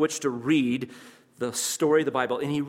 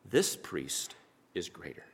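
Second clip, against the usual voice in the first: solemn low quote; 7 semitones lower, 7 dB quieter, slower than usual. Acted. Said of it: "And he's gonna say".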